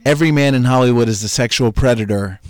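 ordinary talk

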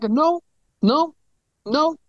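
high, separate little denials; repeated denial. Retold or imitated. Patient denies each symptom the doctor lists, high-pitched.

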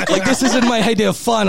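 high, laughing disbelief; laughing through it. Real, not acted. Laughing while retelling the ordeal.

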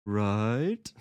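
"Right?" drawn out with a climbing pitch; rising intonation. Shown as a demonstration. Teacher demonstrating question tune, "Right?" rising. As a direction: stretch "Right?" upward into an obvious question. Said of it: "rising intonation that makes it obvious".

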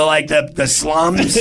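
normal talking voice

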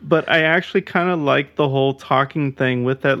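ordinary talk

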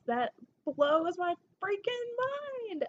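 high, drawn-out exclamation of amazement; amazed. Real, not acted. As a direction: stretch it out slowly, pitch climbing high. Amazed at the listener numbers.

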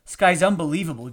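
snapped. A narrator's reading. Drunk husband fed up with the grandfather, snapping loudly. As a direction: snap it out in disbelief. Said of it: "Jerry snapped".

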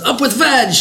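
high mock slogan cheer; playful high-pitched chant; quoted rallying cry. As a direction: imitate a storybook hero's high rallying call. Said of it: "when he says".

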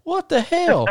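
very high mock outrage; retold falsetto astonishment; imitating incredulous drummer. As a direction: pitch it way up in disbelief. Retold disbelief at ignorance.

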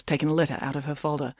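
plain reading voice

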